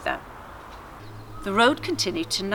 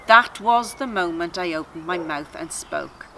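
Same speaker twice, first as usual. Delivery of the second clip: plain reading voice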